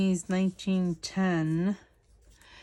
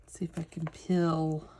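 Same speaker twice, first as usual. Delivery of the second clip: "peel" in a Southern accent, said like "pill"; Southern accent turning "peel" into "pill"; 4 semitones lower than usual. Real, not acted. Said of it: "My southern accent came out there".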